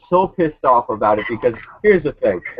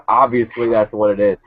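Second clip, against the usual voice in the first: ordinary talk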